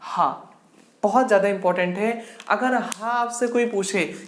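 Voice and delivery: usual speaking voice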